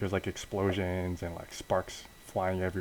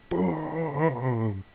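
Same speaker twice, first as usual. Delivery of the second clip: long, high-starting "boom" falling away; drawn-out mouth-made explosion; imitated explosion; 7 semitones higher than usual. Narrator describes the blast devouring both, imitated boom.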